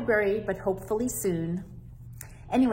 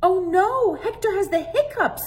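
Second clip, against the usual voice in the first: put-on alarmed child; 11 semitones higher, 5 dB louder than usual. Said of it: "Sofia said".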